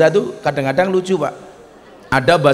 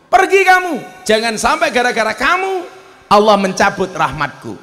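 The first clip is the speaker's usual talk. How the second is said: high, raised voice driving her out; angry Musa; 7 semitones higher than usual. Acted. Musa angrily chasing the sinful woman from his house. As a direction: order her out in anger. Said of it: "Nabi Musa marah".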